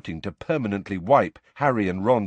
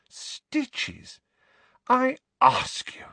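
high exasperated whisper; scoffing; much slower than usual. A narrator's reading. Daughter scoffing about her dad in a whisper. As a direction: scoff under your breath.

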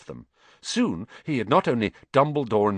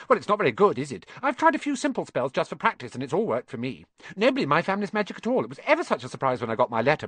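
put-on high, rattling bossy-girl chatter; very fast; 6 semitones higher, faster than usual. Hermione introducing herself to the boys, very fast. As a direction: gabble on eagerly, barely pausing. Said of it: "She said all this very fast".

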